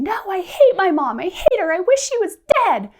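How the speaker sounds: put-on high, loud child's wail; a kid shrieking in a rage; mock child tantrum, high and loud; upset child yelling. Upset kid yelling about mom.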